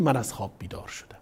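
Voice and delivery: a hushed rushed whisper closing the story; dramatic whisper. Acted. Preacher telling the congregation how the woman woke from her dream, in a quick whisper. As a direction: whisper it quickly, breath only.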